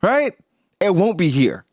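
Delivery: high, loud, smug warning; cold villain reveal. Retold or imitated. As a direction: sneer it out above his own voice. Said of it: "he's saying".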